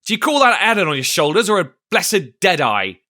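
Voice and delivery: high, loud mocking rebuke; scornful cry. A narrator's reading. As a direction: berate him for a fool. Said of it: "cried Long John".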